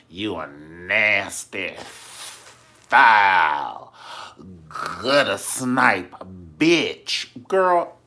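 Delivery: slow, loud, drawn-out insult; venomous insult. Retold or imitated. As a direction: spit each word out slowly and loudly. Insult hurled in quarrel retold.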